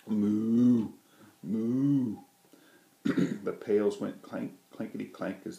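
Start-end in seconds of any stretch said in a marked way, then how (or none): 0.1-2.2 loud mooing; cow moo. A narrator's reading. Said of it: "Big Bossy Cow said".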